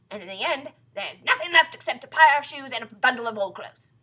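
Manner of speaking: loud, measured, dramatic warning; doom-laden Mr Twit. Acted. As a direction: build the horror phrase by phrase. Voices Mr Twit's gruesome prognosis.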